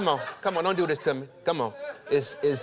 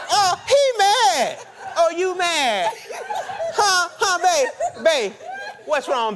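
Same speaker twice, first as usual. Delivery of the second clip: much higher and louder teasing sing-song; mocking wife voice; 10 semitones higher, 5 dB louder than usual. Wife mocking her angry husband in a high, loud voice. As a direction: taunt him like a playful wife. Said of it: "She says".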